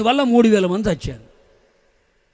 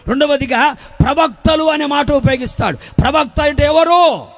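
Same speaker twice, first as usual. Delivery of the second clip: high, driving sermon delivery; full-force preaching; 5 semitones higher, 5 dB louder than usual. Real, not acted. Preacher rousing the church about the foundation, full-force preaching.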